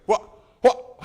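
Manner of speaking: high-pitched yelp of disbelief; mock child's incredulous squawk; shocked child. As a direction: squeak it out, startled and loud. Child shocked again at spanking.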